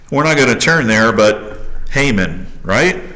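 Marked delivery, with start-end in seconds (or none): none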